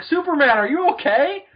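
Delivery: high, loud rescuer's shout; calling out. Acted. Helicopter crew calling to Superman, acted shout. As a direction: holler up in hammed-up concern. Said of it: "helicopters surround the area and just like".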